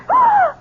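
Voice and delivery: a sharp high gasp of shock. Acted. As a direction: gasp as if seeing a ghost. Sees dead woman alive.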